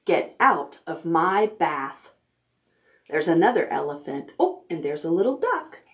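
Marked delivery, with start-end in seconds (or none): none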